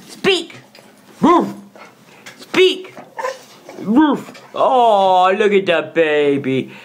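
ordinary delivery

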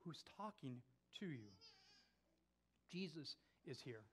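soft, low, with long pauses; hushed preaching. Real, not acted. Preacher assures church that Jesus is here, hushed and low. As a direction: let each assurance settle quietly.

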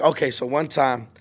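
normal talking voice

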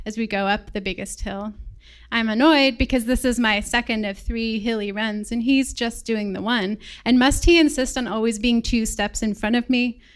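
ordinary talk, nothing marked

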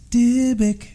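high, eerie ghostly "Dybbuk"; weird woman's voice. Retold or imitated. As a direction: imitate a spooky female ghost voice saying it. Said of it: "in a really weird woman's voice, it goes".

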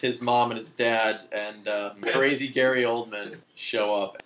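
usual speaking voice

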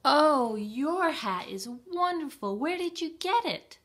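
high, loud little hen voice; admiring hen. Acted. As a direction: gush over the snowman's hat. Hen admires snowman's hat.

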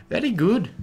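high, lilting Irish-tinged accent; exaggerated Irish lilt; mock Irish accent. Shown as a demonstration. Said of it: "I went a little Irish there".

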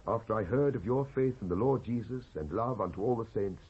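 ordinary delivery

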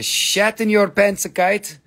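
usual speaking voice

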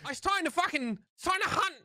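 high, laughing broad Australian bogan calling his squad; rally the team like a rough Aussie bogan, chuckling; Bogan Australian accent. Pretend. Asked by co-host for a Skye voice line, high bogan Australian accent. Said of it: "like, the Bogan accent?".

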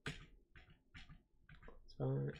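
a soft whispered echo. Shown as a demonstration. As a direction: whisper it to yourself. Repeats the line whispered.

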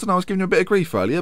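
normal talking voice

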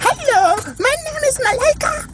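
squeaky voice. Pretend. Squeaky fast self-introduction.

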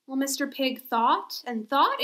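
ordinary talk, nothing marked

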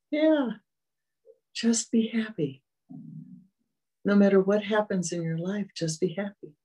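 sighed, high 'yeah' melting into a whisper; sudden realization. Retold or imitated. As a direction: let it dawn on you with a breathy sigh. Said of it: "And I went".

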